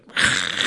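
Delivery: voiceless gremlin snarl; mock creature snarl. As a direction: imitate a gremlin attacking, all rasping noise. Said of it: "goes full, like, gremlin".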